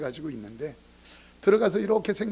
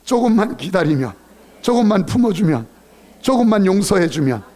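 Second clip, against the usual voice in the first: louder and louder repetition; preaching build; 3 semitones higher, 7 dB louder than usual. Acted. Pastor urging patience, building to a shout. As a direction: build each line more urgent.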